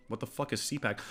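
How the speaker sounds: muttered confusion. Retold or imitated. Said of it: "I was like".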